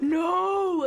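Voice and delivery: high, loud cry of no; panicked shout. Retold or imitated. Heroine stopping the man burning her flowers, panicked shout. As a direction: yell to stop him in time.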